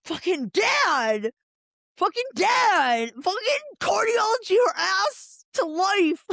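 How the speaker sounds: slow, rising mock fury; hammed-up angry yell; mock angry rant. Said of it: "He was like".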